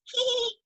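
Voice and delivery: very high little hee hee giggle; a tiny high-pitched giggle; mock giggle. The strange lady giggling as she walks out, high mock giggle. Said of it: "and then giggles".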